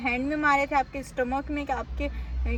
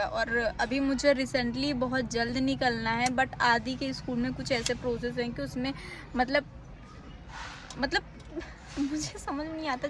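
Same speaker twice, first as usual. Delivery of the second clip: normal talking voice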